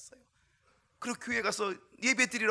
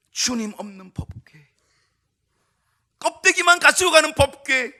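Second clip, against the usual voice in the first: building preaching; 8 semitones higher, 20 dB louder than usual. Acted. Pastor driving home the empty ark, rising to loud preaching. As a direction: build each line bigger than the last.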